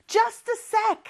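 high-pitched quick reply as busy dad. Acted. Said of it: "아빠는 바쁜가 봐요".

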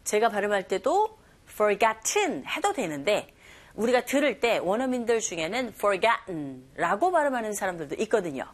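ordinary talk

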